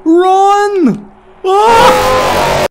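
high terrified shrieks; panicked screaming. Real, not acted. Panicked flight from monster.

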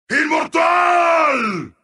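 high, long-held announcer cry; triumphant announcer shout. Acted. Announcer declaring a player immortal, triumphantly. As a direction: hold the last syllable high and long. Bigger take of announcer call.

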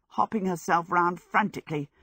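ordinary delivery

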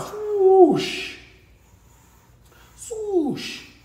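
high swoosh sound effect. Shown as a demonstration. Teacher sounding out the stroke, high swoosh. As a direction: whoosh it up high like wind.

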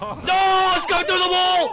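high loud panicked yell; scared shout. Real, not acted. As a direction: yell as it bursts in. Monster breaks through wall.

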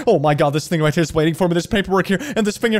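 fast, high-pitched panic rant; acted anxiety meltdown. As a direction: build from frantic rush to a high, loud freak-out. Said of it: "This is what it feels sometimes to run a YouTube channel".

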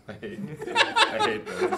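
high voice breaking into laughter; high-pitched laughing protest; real helpless laughter. Real, not acted. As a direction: groan it out while cracking up. Cracking up over the failed pun.

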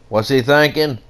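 normal talking voice

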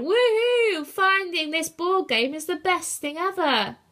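high, loud whoop of glee; excited cheering. A narrator's reading. Brutus thrilled by the board game, excited cheering. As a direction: cheer it out with delight.

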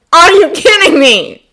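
loud, high, drawn-out disbelief; stretched, incredulous yell; incredulous outburst. A narrator's reading. Incredulous teen exclamation in story.

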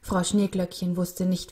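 usual speaking voice

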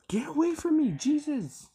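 high loud terrified yell; panicked scream. Real, not acted. Player chased by the monster, high panicked scream. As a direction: scream for your life.

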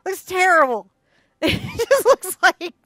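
loud, high excited gushing; real excitement. Real, not acted. Painter showing students the eye appear, really excited. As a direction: get carried away, pitch and volume jumping up. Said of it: "I got a little excited".

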